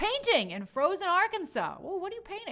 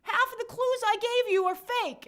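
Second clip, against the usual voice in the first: mocking taunt; 12 semitones higher, 6 dB louder than usual. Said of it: "and then be like".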